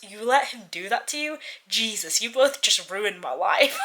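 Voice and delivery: loud, disgusted accusation; angry outburst. Acted. Johnny at his parents, loud disgusted outburst. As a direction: yell it at the parents. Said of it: "glowered at his parents".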